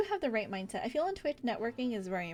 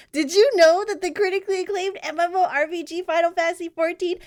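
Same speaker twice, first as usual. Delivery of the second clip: loud, high, breathless ad-style recitation; reading the Final Fantasy copypasta as a loud mock ad; hammed-up copypasta ad read; 6 semitones higher, 9 dB louder than usual. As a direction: blast the meme pitch like an overexcited commercial. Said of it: "the copy pasta".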